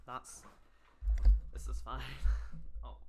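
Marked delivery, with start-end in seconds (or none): 2.1-2.8 short laugh. Real, not acted. Reacting to the fanfic request.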